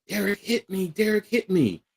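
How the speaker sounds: high, plaintive imitation of a tearful sister; imitated crying. Crying sister tells on her brother, imitated crying. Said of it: "my sister's crying".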